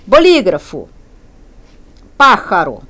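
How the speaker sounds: Bolígrafo on LÍ, Pájaro on PÁ; stress on antepenultimate syllable. Shown as a demonstration. Teacher showing esdrújula words, stress on LÍ in Bolígrafo, PÁ in Pájaro. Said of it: "antepenúltima sílaba tônica".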